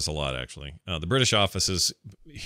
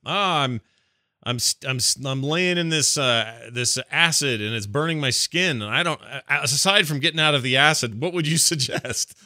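mock distressed caller; 5 semitones higher, 4 dB louder than usual. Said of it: "it's like saying".

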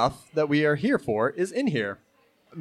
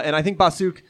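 usual speaking voice

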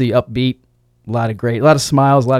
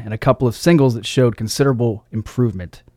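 ordinary talk

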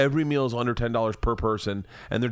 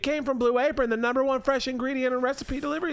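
much higher, sing-song wife voice; wife Leanne voice; 12 semitones higher than usual. Acted. Husband voices wife Leanne pitching Blue Apron, high sing-song. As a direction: play the chirpy wife selling the meal kit. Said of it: "Do you like this character thing I'm doing?".

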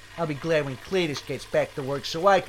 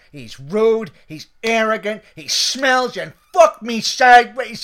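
loud list of complaints, building to an outburst; exasperated rant; 3 semitones higher, 10 dB louder than usual. Acted. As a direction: rant to the boss, louder with each complaint. Cook rants about new waiter.

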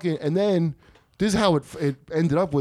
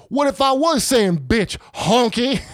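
angry retort; 7 semitones higher, 4 dB louder, slower than usual. Retold or imitated. Old guy jumps up angry.

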